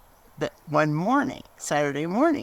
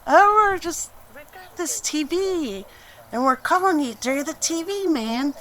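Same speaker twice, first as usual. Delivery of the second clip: high, put-on voice of pranksters; mock cool prank voice; 11 semitones higher, 4 dB louder than usual. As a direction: act the smug caller teasing a friend. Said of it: "we were just like".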